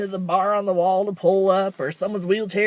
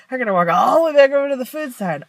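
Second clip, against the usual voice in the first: louder, higher and fast, exasperated; exasperated complaint; 6 semitones higher, 8 dB louder, faster than usual. Retold or imitated. To her mom, exasperated about walking back. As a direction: blurt the complaint in frustration. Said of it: "I'm like".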